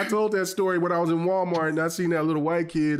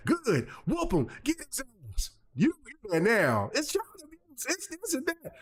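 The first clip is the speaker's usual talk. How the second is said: onlooking parents; 11 semitones higher than usual. Retold or imitated. Bystanders commenting on the spanking, high mock voices. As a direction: cheer on the spanking like nosy shoppers.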